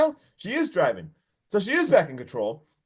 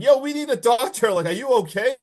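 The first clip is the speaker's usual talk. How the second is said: high, raised-voice mock alarm; retold mild scream; 7 semitones higher, 4 dB louder than usual. Retelling Josh seeing the blood and calling for a doctor, mock yell. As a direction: imitate someone calling out urgently for help. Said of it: "He starts screaming".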